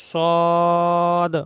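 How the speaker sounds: "সদ" with rounded lips and a heavy s; rounded Arabic letter. Shown as a demonstration. Teacher demonstrating the starred letter, lips rounded. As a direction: round the mouth for "সদ". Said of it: "মুখটা একটু গোল হবে".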